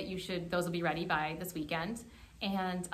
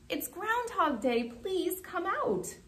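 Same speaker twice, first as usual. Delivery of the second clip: high, drawn-out crowd call; mild read-aloud shout; storybook shout; 11 semitones higher, much slower than usual. A narrator's reading. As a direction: call out high, stretching the last words. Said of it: "said with a shout".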